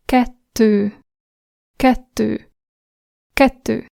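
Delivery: "kettő" with the tt held long; long double t. Shown as a demonstration. Teacher modelling a Hungarian double consonant, held. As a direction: hold the tt in "kettő" before releasing. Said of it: "And the double version".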